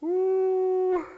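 high, sustained, loud ooh; loud whoop. Real, not acted. Preacher reacting after the congregation laughs, high whoop. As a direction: let out a long high hoot.

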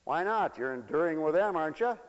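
ordinary delivery

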